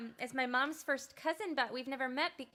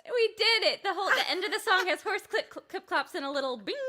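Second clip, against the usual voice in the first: high and loud, laughing; triumphant laughing announcement; gleeful; 7 semitones higher, 8 dB louder than usual. Real, not acted. Proud of the joke sound effects.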